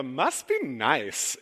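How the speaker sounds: slow, drawn-out envious must be nice; coworker's catchphrase. Retold or imitated. Pastor mimicking a coworker's jealous catchphrase, slow and sour. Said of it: "the reason I bring up the".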